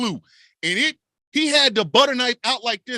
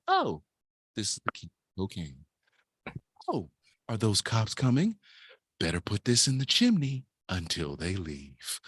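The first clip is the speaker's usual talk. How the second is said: a lower, hushed murmur; sly murmur; 13 semitones lower, 7 dB quieter than usual. Retold or imitated. A man hiding cocaine from cops, low and hushed. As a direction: keep it low and sneaky. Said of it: "You climb up there and go".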